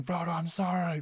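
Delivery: high-pitched but restrained mock shout. Boromir calling to Frodo in remorse, lightly mimicked shout. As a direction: imitate a desperate cry without full volume. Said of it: "he's, like, shouting".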